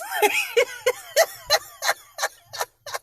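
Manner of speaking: high cackling laugh; big high laugh; loud laugh. Real, not acted. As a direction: burst out laughing at own joke. Laughs at her joke.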